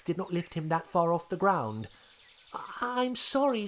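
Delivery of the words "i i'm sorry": put-on high, hurried little apology from a scared young dinosaur; frightened apology. Young dinosaur apologising to the brigadier, higher and startled. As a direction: stammer out sorry, a bit startled. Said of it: "cried Thomas".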